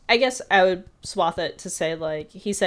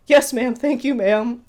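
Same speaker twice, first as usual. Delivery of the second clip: higher, louder, hasty-to-please retold reply; mock meek obedience; 6 semitones higher, 7 dB louder than usual. Tough guy answering a bossy waitress, mock obedient. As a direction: play at being cowed by the waitress.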